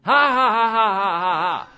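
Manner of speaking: put-on laugh that falls in pitch; demonstrative belly laugh. Shown as a demonstration. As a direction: laugh out a descending ha-ha run.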